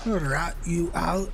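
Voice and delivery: put-on slow, drawn-out Scottish accent copying the game line. Streamer echoes a game character in a slow Scottish accent. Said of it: "Sounds Scottish".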